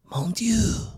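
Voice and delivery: mock French gasp, high and whispered; french chef. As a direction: exclaim like a shocked French chef.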